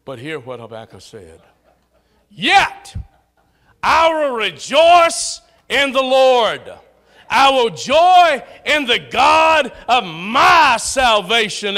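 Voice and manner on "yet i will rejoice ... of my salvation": loud, slower, crying out the verse; fervent preaching cry of joy; proclaiming shout. Real, not acted. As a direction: proclaim it with fervor.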